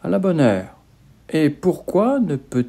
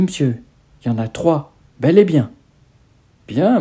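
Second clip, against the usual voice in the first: usual speaking voice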